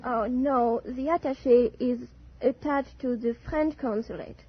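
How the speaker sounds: normal talking voice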